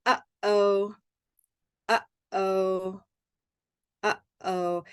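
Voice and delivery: "uh-oh" with a clear catch in the throat; glottal stop demo. Shown as a demonstration. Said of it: "you can feel it by saying".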